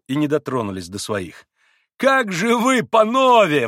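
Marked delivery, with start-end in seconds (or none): 2.0-3.7 high, loud protest; dismayed exclamation. A narrator's reading. Mitya reproaches the Poles for not drinking, exclaiming. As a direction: cry out in reproach. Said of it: "воскликнул Митя".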